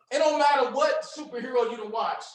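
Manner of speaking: normal talking voice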